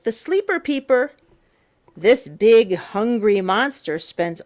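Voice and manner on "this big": ordinary delivery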